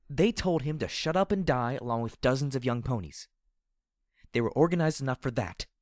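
shouted with bitter rage; loud angry outburst. Acted. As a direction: raise your voice, furious.

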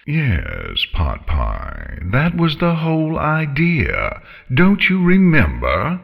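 lilting, condescending villainous dragon; nonchalant menacing dragon. Acted. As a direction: remind your prey with mock patience. Voices the dragon Scarlet.

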